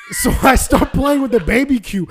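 ordinary talk, nothing marked

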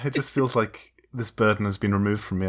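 ordinary talk

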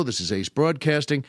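ordinary talk

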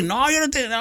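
evasive excuse. Retold or imitated. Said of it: "friends backing out".

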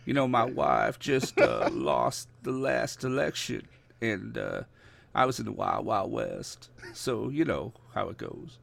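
soft Southern politician drawl; Bill Clinton. Acted. Cohost offers to talk like this, as a drawling ex-president. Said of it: "is Bill Clinton one of the legends?".

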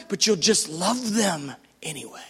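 hushed whisper after the climax. Real, not acted. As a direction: drop from the build into a whisper. Tender point after rising build.

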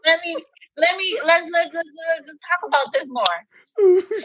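high, giggly stammering; laughing eagerly. Retold or imitated. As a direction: gush through laughter. Excited about the handsome brother.

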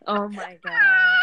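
high, loud laughing Oh my God; laughing hard. Real, not acted. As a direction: crack up laughing. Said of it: "Y'all crazy".

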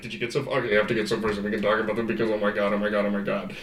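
low, rapid-fire run-on imitation; rushed group chatter. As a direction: imitate excited friends in one hurried low stream. Retells group rushing to discuss.

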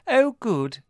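high, loud sing-song trill; fluted. A narrator's reading. Margot delighted Adrian agreed, fluting. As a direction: flute it sweetly. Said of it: "fluted Margot".

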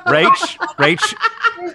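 very high laughing Rach; laughing call. Real, not acted. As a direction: call her name through laughter. Laughing calling out.

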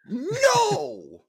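shrieking high coach outburst; Coach Greg voice. Pretend. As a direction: bark a piercing "No!" way up high. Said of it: "put your, uh, Coach Greg voice on".

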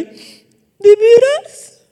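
high, stretched, disbelieving "de veras"; tearful girl suddenly hopeful; hopeful surprise. Retold or imitated. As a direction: lift the voice way up, slow and amazed. Girl lifts face, hopeful.

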